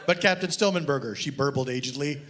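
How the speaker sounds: deadpan reading of a mock detective novel; story reading. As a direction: read it dry, like a serious audiobook. Author reads his novel aloud.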